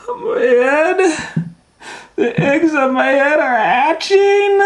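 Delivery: very high, stretched-out shriek; panicked wail. Acted. Elephant horrified that eggs hatch on his head, shrieking. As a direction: shriek it in mounting disbelief.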